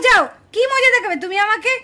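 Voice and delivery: usual speaking voice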